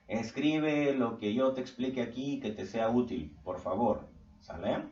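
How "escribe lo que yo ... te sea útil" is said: ordinary talk, nothing marked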